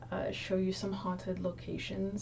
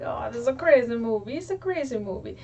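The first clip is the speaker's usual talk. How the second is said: put-on high, loud, quick Italian accent; Italian-accented dad; 5 semitones higher, 8 dB louder, faster than usual. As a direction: imitate an excitable Italian father, voice leaping up. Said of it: "hear it in an Italian accent".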